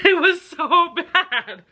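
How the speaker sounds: high, drawn-out wail through laughter; amused exasperation. Real, not acted. Reader giving her verdict on the book, laughing exasperation. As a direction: stretch it out high, laughing in disbelief.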